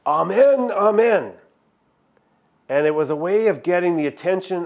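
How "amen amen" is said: imitating a professor, louder and higher; a touch louder, higher mock professor; professor's emphatic amen. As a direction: ring out both amens like an old professor reading aloud. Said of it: "he would say".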